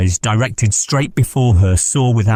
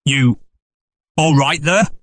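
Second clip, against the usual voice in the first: higher, sharp call through the door; 6 semitones higher than usual. Acted. Innkeeper calling sharply through the parlour door again. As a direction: demand an answer sharply. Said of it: "asked Mister Hall sharply again".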